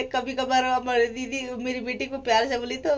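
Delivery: ordinary talk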